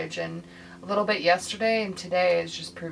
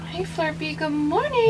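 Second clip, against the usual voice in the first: pet voice; 8 semitones higher, 4 dB louder than usual. Real, not acted. Greeting the pet.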